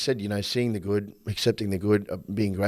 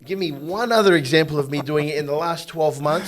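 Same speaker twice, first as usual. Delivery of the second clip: loud, higher, insistent challenge; demanding facts; 6 semitones higher, 5 dB louder than usual. Retold or imitated. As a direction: press her for proof, point by point.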